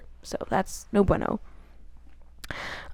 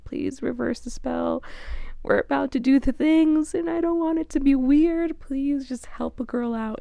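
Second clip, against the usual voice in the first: pleading, pitched higher, mildly hammed-up panic; mock panicked plea; 6 semitones higher than usual. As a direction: play her begging in a half-joking panic. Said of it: "She's panicking".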